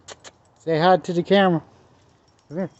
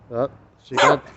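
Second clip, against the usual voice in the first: usual speaking voice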